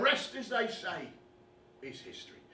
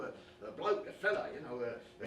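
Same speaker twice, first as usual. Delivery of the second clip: ordinary talk, nothing marked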